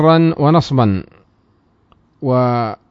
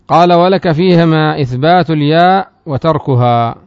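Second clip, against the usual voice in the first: raised, louder recitation of the source text; reading the text aloud; 3 semitones higher, 6 dB louder, slower than usual. Shown as a demonstration. Teacher reciting the grammar text to students, raised voice. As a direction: recite the matn line for the class.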